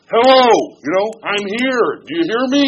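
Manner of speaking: loud, high attention-grabbing yell; yelling in your face. Acted. God right in your face to get your attention, yelling. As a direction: shout it like demanding to be heard. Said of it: "yelling at you".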